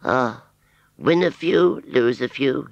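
high rustic yokel musing; Wurzel's rustic voice. Acted. Scarecrow Wurzel musing to himself after rejection, rustic shrug.

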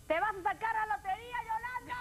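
high-pitched excited outburst; excited shout. Real, not acted. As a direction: shriek it like a big win. Co-host jokingly exclaims after the reading.

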